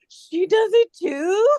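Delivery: gasp, then high drawn-out astonishment; amazed. Retold or imitated. Overhearing brother's girlfriend call Siri, mock amazed. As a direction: replay a stunned discovery, slow and high.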